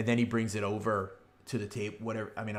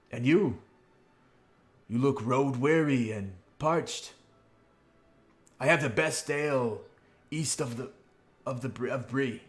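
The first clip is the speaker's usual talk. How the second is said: hearty host's pitch, a little raised; jovial innkeeper; 4 semitones higher, 4 dB louder than usual. Acted. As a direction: sell the house ale to weary guests. Innkeeper pitches ale to travellers.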